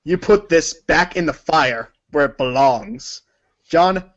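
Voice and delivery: ordinary talk, nothing marked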